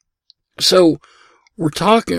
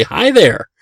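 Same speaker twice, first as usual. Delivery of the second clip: higher chirpy parrot hello; mynah bird voice; 8 semitones higher, 4 dB louder than usual. Retold or imitated. Pet store mynah greets customers, higher chirpy bird voice. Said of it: "he could imitate that bell and immediately".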